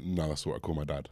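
flat, merciless deadpan refusal. Retold or imitated. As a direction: shut him down dead-faced, no mercy. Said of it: "dead faced, no mercy".

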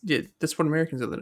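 normal talking voice

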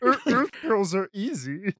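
comic spaceman impression; Marvin the Martian. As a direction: imitate a cartoon Martian, drawn out. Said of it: "they kinda sound like Marvin the Martian".

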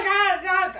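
normal talking voice

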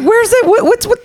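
high, giggling bewildered imitation; confused laughing. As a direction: squeak out the confusion through laughter. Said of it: "I was like".

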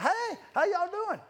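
high, slow, friendly call; cheery greeting. Retold or imitated.

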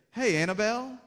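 a hint of a parent's hello; parent greeting baby. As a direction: call out to your baby girl. Parent calling to newborn baby.

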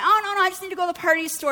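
high, fast, offhand; breezy stranger. Retold or imitated. Woman reassuring the preacher about the ride, quick and high. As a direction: wave it off quickly. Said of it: "she's like".

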